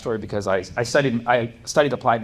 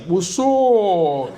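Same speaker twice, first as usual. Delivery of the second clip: Pythagoras greeting; 5 semitones higher, 4 dB louder than usual. Retold or imitated.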